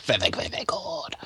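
hushed whisper. Acted. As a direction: drop to a breathy whisper. Sudden whispered aside.

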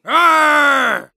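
loud, high pirate roar; pirate arr. Acted. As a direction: bellow it like a hammy pirate. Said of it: "Or a pirate".

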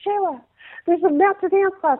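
high, eager call of her name; excited cousin. Retold or imitated. Cousin runs in to tell Shayla, excited and high.

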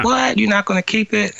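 high, incredulous squeal of disbelief; can't believe he'd give the song away; shocked disbelief. Retold or imitated. Band stunned [name] would give song away, squeaky disbelief.

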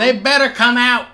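loud, high mock ranting cop; bullhorn rant. Cop ranting at the town through a bullhorn, loud mock yell.

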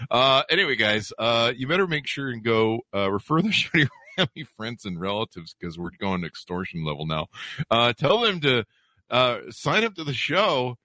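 ordinary delivery

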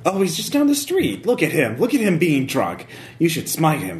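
eager busybody townswoman. Acted. GM voicing townswoman NPC.

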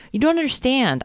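put-on high childish whine; whiny. A narrator's reading. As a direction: whine it like a sulky kid. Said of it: "I whine".

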